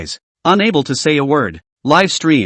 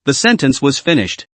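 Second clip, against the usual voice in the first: plain reading voice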